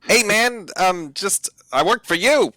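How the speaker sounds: high, put-on voice of a cornered dwarf; devilish dwarf voice. Devilish dwarf answers in character.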